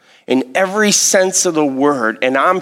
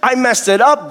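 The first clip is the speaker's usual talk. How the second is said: higher-pitched retold cry of regret; 6 semitones higher than usual. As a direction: imitate a man owning up to God, pitched up. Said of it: "David is crying out and he's like".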